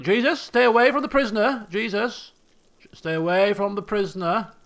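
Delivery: loud warning shout; calling out. Real, not acted. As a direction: yell across the map to stop him. Calling out to a colonist.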